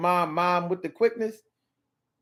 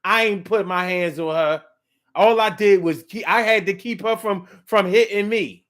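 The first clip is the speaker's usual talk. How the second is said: raised, louder whining denial; mock tearful yelling; 5 semitones higher, 6 dB louder than usual. Terrence insists he only defended himself, mock crying. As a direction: act the crying liar pleading innocence. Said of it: "yelling, screaming, and crying and lying".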